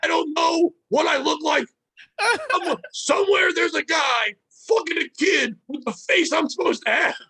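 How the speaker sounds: high-pitched mock indignant rant; breaking character. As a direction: imitate a comic lashing out at a heckler. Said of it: "he broke character".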